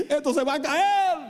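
mock panic. Imagined pilot running out in panic, mock alarm. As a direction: cry out that the plane is falling, hammed-up.

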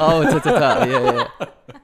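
giggly playful chant; laughing singsong. Real, not acted. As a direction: laugh through the words. Echoing the tart chant laughing.

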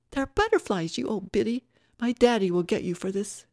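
high, loud indignant woman; petulant, threatening Tara. Acted. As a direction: snap back with a spoiled threat.

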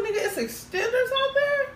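high, laughing outburst of surprise; amazed disbelief. Retold or imitated. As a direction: squeal it like you can't believe it. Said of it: "I said".

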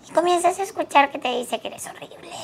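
squeaky high little monster; friendly monster voice. Shown as a demonstration. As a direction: play the silly inner critic monster. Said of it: "estoy tratando de hacer mi voz de monstruo".

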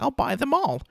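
pitched high, giddy and eager; a quick hammed-up impression of the obsessed fan; eager chocolate fan. Quoting the chocolate-obsessed fish to the viewers, high giddy eagerness. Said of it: "he's like".

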